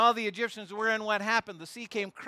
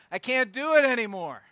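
exhausted giving up; 4 semitones higher, 7 dB louder than usual. Retold or imitated. Worn-out believer voiced.

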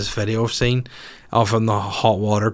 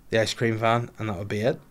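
normal talking voice

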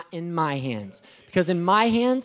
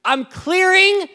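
much higher and louder, shouting at God in disbelief; exasperated outcry; 9 semitones higher, 10 dB louder than usual. Retold or imitated. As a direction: cry out up high, fed up with clearing ground. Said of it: "You're like".

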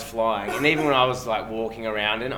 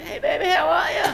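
high mock greeting in Legacy's voice; squeaky buddy-buddy hello, hammed up; mock Legacy greeting, way higher; imitating Legacy; 3 dB louder than usual. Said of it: "The first thing he says, like".

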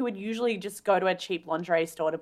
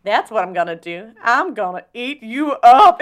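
scary mock menace; 3 semitones higher, 8 dB louder than usual. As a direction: drag out the threat, laughing and high. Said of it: "It's so scary".

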